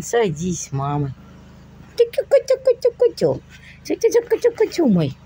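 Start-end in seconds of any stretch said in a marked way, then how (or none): 2.0-5.2 put-on very high baby coo. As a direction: coo at the kitten. Cooing to kitten. (высокое сюсюканье)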